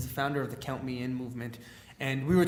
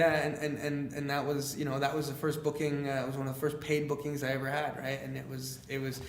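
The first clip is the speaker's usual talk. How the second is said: ordinary talk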